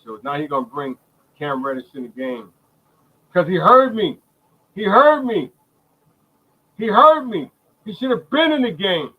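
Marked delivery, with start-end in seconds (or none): 3.3-9.1 high, loud, gloating yell; triumphant shout. Real, not acted. Streamer to his viewers, crowing that the coach heard him. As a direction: crow that the coach listened to him.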